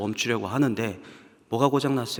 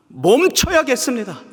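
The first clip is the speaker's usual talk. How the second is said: loud vow; 10 semitones higher, 7 dB louder than usual. Acted. Repeated vow, first take.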